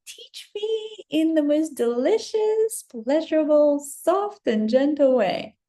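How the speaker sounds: high, slow, honeyed request; sweet dreamy plea. Retold or imitated. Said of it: "my thing is, like".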